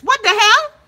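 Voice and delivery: high, loud what the hell; shocked. Real, not acted. Host reacting to a viewer's odd comment, high and loud. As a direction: pop up loud in disbelief.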